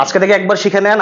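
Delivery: ordinary talk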